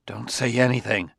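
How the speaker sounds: plain reading voice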